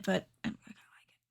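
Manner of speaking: quick whispered aside; whispering. Real, not acted. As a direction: whisper it under your breath. Aside while someone else talks.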